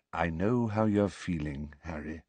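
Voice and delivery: low, soft and hushed, almost under the breath; very quietly. A narrator's reading. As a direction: speak it gently down to the floor. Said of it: "said Dumbledore very quietly".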